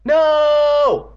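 long, high, loud wail; alarmed yell. Acted. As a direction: belt it out high and drawn out. Character cries out in alarm.

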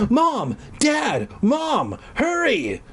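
high-pitched calls for help; mock shout, pitched high; acted shouting. A narrator's reading. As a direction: call out urgently, up high. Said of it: "Sarah was shouting".

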